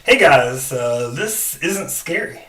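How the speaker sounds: ordinary talk, nothing marked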